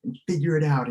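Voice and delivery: loud, high barked order to the bench; angry coach order. Retold or imitated. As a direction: send him to the bench in anger. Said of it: "He's like".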